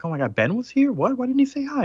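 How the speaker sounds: high, excited gasping question; excited girlfriend. Retold or imitated. Alicia finds out Ben came, excited high voice. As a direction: Alicia thrilled that Ben was here.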